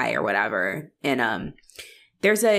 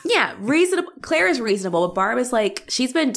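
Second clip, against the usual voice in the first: ordinary delivery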